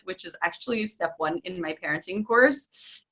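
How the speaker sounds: normal talking voice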